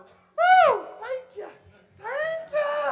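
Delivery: high, whooping praise; hammed-up ecstatic worshipper, loud and very high; Sister Helicopter. Preacher mimicking an excitable sister in church, whooping.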